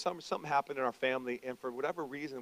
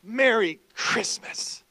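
slow deliberate greeting; 9 semitones higher, 8 dB louder, much slower than usual. Retold or imitated. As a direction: stretch "Merry Christmas" out slowly. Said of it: "You're like".

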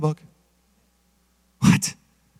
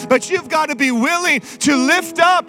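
full-force preaching; 5 semitones higher than usual. Acted. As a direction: preach it hard to the church.

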